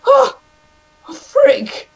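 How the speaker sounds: high, rapid-fire panicked no-no-no; real gamer panic, pitch shooting up. Real, not acted. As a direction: yelp in alarm as you get swarmed. Panicking at a game ambush.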